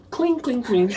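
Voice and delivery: high clinking noise for pills; ping each word like pills dropping; pill clink sound. Retold or imitated. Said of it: "imitated pill sound".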